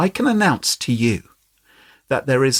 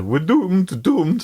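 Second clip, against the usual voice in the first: higher, hammed-up Scottish wail of doom; 5 semitones higher, much slower than usual. Host showing off his best Scottish voice, hammed-up doom. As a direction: put on a theatrical Scottish accent. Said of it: "Scottish voice".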